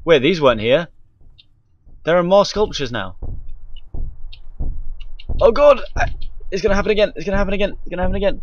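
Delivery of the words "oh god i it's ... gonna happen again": high, fast, frantic repeats; real rising panic; panicked dread. Real, not acted. Dreading the next scare.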